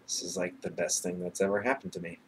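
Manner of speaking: holding back real tears as you speak; quiet emotional catch in the voice; getting emotional. Real, not acted. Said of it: "didn't really mean to get emotional".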